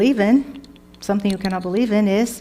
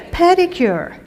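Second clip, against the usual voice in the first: playful; 9 semitones higher, 4 dB louder than usual. Acted. Teacher playfully addressing ladies.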